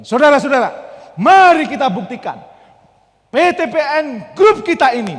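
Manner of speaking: loud, slow rallying call; rousing speech. Acted. Speaker rallying the company staff, loud and slow. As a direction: fire up the whole company.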